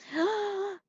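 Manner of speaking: sharp gasp of mock surprise; dramatic gasp. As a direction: gasp for the kids. Reading to children, gasp.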